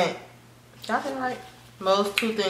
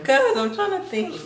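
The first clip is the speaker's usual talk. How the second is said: high giggly voice; laughing; 11 semitones higher than usual. Real, not acted. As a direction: giggle while speaking. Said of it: "Why are you laughing?".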